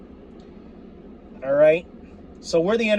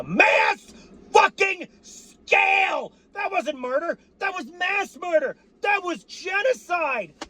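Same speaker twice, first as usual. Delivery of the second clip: furious shout; 12 semitones higher, slower than usual. Real, not acted. Fan screaming at the beaten Cowboys in outrage. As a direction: yell it at the rival team.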